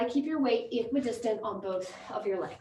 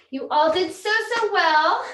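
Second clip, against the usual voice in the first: excited praise; 7 semitones higher, 6 dB louder than usual. Real, not acted. Coach praising the class.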